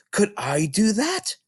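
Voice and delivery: put-on a small girl answering the librarian; Matilda, young girl. Little Matilda explaining to Mrs Phelps, young girl voice.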